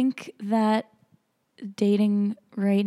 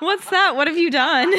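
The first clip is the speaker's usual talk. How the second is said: laughing; 10 semitones higher, 7 dB louder than usual. Real, not acted. Reacting to friend's voice crack.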